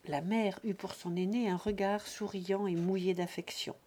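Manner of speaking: ordinary delivery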